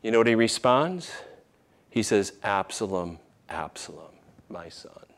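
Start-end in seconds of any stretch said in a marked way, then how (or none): 2.4-5.0 fading to a near-silent, grieving murmur; a father's heartbroken lament, dying away. Retold or imitated. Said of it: "He is grieving deeply".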